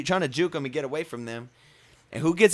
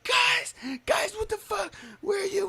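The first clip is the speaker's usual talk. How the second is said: high, pleading, near-crying voice; high desperate phone plea to friends; panicked whine; 4 dB quieter than usual. Retold or imitated. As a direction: squeak it out like a beaten kid begging for help. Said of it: "call my friends, like".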